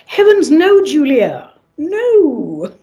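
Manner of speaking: high, loud heavens no; shocked. Real, not acted. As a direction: gasp it out, scandalised. Shocked at the question.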